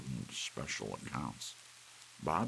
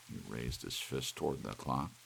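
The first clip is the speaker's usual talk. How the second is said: plain reading voice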